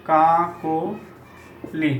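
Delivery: কাকলি broken slowly into "kaa... ko... li"; teacher spells out কাকলি by sound; syllable demo. Shown as a demonstration. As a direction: draw out each syllable of কাকলি, rounded o. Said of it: "কাকলি উচ্চারণ হবে".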